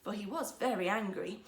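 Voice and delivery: ordinary delivery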